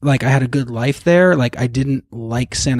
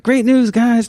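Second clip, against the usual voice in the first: high, cheery mock-dad proclamation; upbeat dad announcement; 9 semitones higher, slower than usual. Dad walks in with news for his two boys, upbeat announcement. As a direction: announce it like a pleased dad, pitched up.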